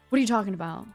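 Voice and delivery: ordinary talk, nothing marked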